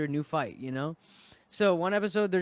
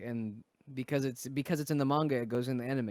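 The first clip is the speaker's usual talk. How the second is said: normal talking voice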